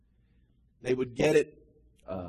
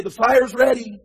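loud, higher shouted answer; calling back; 8 semitones higher, 12 dB louder than usual. Retold or imitated. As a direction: call down the answer from the top. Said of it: "the voices from on top of the cliff would say".

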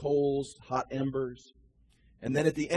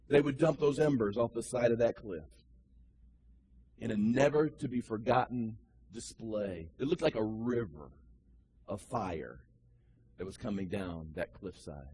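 usual speaking voice

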